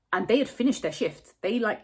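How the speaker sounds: normal talking voice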